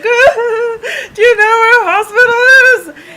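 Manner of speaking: high, loud, slow blubbering; mock crying. Panicked driver asking a stranger for help, hammed-up crying. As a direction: wail the question in exaggerated tears. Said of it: "I just start crying".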